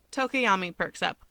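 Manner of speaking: plain reading voice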